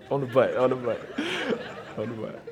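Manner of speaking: giggling through the words, soft and low; laughing. Real, not acted. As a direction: lose it laughing mid-line.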